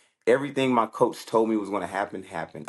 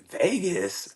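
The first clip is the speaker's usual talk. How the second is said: higher, falling vegas; surprised question; 8 semitones higher than usual. Retold or imitated. Son answering mom's Vegas idea, surprised question. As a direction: ask it surprised.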